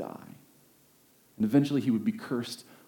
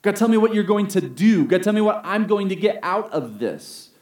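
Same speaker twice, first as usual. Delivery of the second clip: demanding prayer; 5 semitones higher, 6 dB louder than usual. Retold or imitated. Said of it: "My tendency is to put the emphasis on the".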